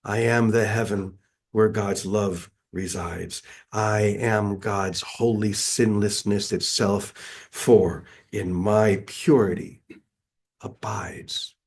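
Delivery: slow, solemn affirmation with long pauses; complete certainty. Acted. Speaker modelling words of holiness for the congregation, complete certainty. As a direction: declare it phrase by phrase, like a creed. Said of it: "say with complete certainty".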